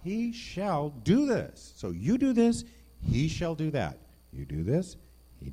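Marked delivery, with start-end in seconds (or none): 0.0-4.0 high, loud and very slow, each word hammered; emphatic promise. Acted. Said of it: "That's a promise".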